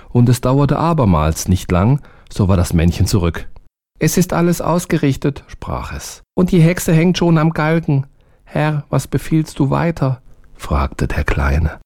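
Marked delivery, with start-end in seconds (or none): 4.0-5.4 high, small servant voice; eager little servant, high. A narrator's reading. Narrator voices the little man. 6.4-10.2 high, small servant voice; eager little servant, high. A narrator's reading. Narrator voices the little man.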